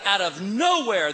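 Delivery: ordinary talk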